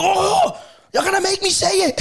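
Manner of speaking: very high hammed-up wail; mock enraged shouting. Customer at the door to the manager, mock shouting. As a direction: imitate an outraged punter threatening. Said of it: "You shouting here".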